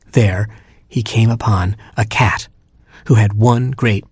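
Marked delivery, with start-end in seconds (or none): none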